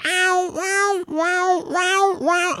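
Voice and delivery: high, spaced-out wheezing mock howls; cartoon accordion cat yowl. Owner imitating the cat all accordion shaped, cartoon yowling. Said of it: "all accordion shaped and shit".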